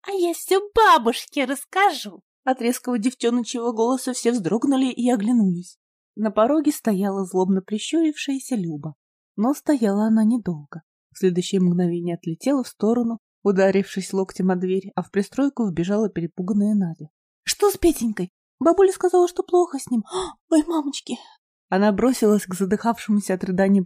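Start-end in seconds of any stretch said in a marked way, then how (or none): 0.0-2.2 put-on high, sharp girlish cry; a spiteful girl shouting a threat; sharp girl's voice. A narrator's reading. Люба threatens the children, high sharp girl's voice. 17.5-21.2 put-on high, breathless, scared girl's voice; frightened girl. A narrator's reading. Надя rushes in to sick brother, frightened high voice.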